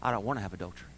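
low, very soft admission; gentle conviction. Retold or imitated. Grace teaching the believer, gentle conviction. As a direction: drop to a quiet personal confession. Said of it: "But grace teaches us".

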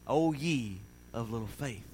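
low, slow, softened words of Jesus; quiet, measured, low-pitched reproach; gentle, unscolding reproof. Retold or imitated. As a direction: chide softly without scolding. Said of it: "He wasn't scolding Peter".

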